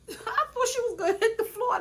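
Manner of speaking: can't stop laughing while telling it. Real, not acted. Telling viewers about the shove, laughing through it.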